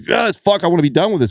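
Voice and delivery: mock exasperated outburst, pitched high; frustrated. Venting mid-struggle about wanting it over, frustrated. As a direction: blurt it out as if fed up. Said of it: "all frustrated with something like that".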